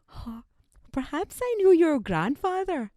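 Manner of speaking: high, lilting Pakistani elder; lightly acted Pakistani busybody; Pakistani person. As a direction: wonder aloud as a mock Pakistani relative, pitch up. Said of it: "if a Pakistani person asks me".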